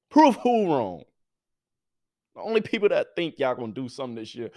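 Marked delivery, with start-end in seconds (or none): 0.1-1.0 high, loud incredulous comeback; incredulous challenge. Real, not acted. Doubter answering Cowboys fans, incredulous challenge. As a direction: throw the question back, pitched way up. Scoffs at Cowboys fans' claim.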